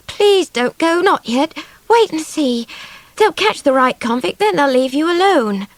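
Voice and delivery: put-on high, pleading child's voice; begging. A narrator's reading. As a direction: keep begging him to stay. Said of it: "begged Swallow".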